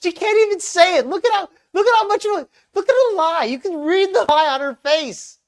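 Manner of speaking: high, loud, incredulous anger; real outrage. Real, not acted. Host exposes a lie on screen, outraged. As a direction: call out the lie at full pitch. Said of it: "I'm so angry".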